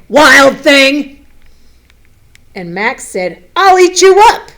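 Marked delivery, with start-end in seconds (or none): 0.1-1.2 loud, high scolding call of a mother; sharp mother's call across the house. Acted. Mother calls Max. 3.6-4.5 put-on loud, much higher defiant kid's shout; a little boy roaring at his mother; wild boy yell. Max shouts back at mother.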